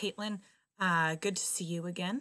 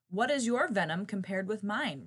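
usual speaking voice

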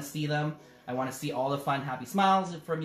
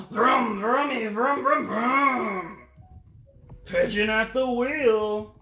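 high, slow car noises; a bird pretending to drive; vroom vroom pigeon; 7 semitones higher, 7 dB louder, much slower than usual. Pigeon plays driving.

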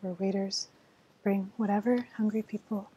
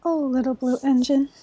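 loud, imploring call; crying out; 3 semitones higher, 9 dB louder than usual. A narrator's reading. Dolls and toys hailing the blue engine, crying out. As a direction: call out louder to get the engine's help. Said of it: "cried the dolls of toys".

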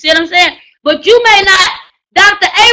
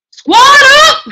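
hype call; 9 semitones higher, 4 dB louder than usual. Real, not acted. Calling the crowd to rally.

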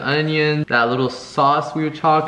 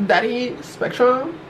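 mock flirty voice; 11 semitones higher, slower than usual. Mock flirty praise of the provider.

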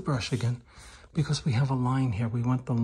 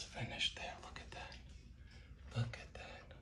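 hushed admiring whisper; soft, breath-only murmur; 17 dB quieter than usual. Real, not acted.

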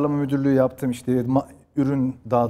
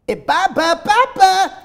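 put-on high, loud teen girl calling dad; ergen kız; 10 dB louder, slower than usual. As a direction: shout for dad like a dubbed teenage girl. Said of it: "İşte kız direkt babaya hemen giriyor". (ergen kız sesiyle bağırarak)

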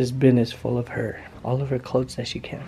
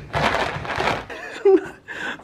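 high laugh. Real, not acted. Laughing after shaking toy.

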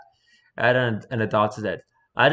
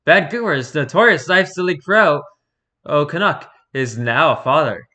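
news anchor voice; 2 semitones higher, 5 dB louder than usual. Acted. News announcement style.

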